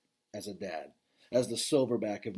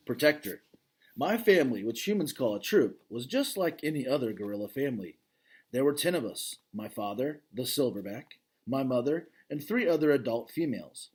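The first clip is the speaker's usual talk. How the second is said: clear, fuller read-aloud voice, brighter than his asides; story narration; 2 semitones higher, 4 dB louder than usual. Acted. Dad reading a gorilla novel to his kids, storytelling narration.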